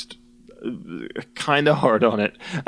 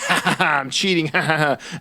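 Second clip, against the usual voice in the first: mock gleeful laugh; 5 semitones higher than usual. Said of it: "the joy that she's like".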